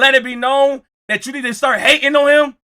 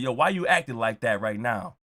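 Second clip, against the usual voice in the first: much lower and softer, quick suspicious voice; low player voice; 9 semitones lower, 10 dB quieter, faster than usual. Retold or imitated. The player questioning the hater, low and suspicious. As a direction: question him low and fast.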